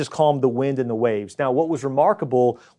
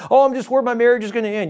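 mock worrier; 8 semitones higher, 4 dB louder, faster than usual. Mocking a worrier.

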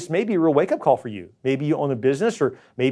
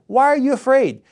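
Jesus rebuking; 10 semitones higher, 5 dB louder than usual. Retold or imitated. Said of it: "Jesus responded".

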